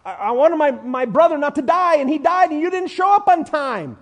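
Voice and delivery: a touch of hurt reproach; reproachful complaint. Retold or imitated. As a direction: accuse him of being late. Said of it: "Basically, Martha's saying".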